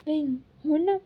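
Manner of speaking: plain reading voice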